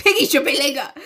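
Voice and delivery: high laughing cheer; echoed rallying cry. Pretend. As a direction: chant along in mock support.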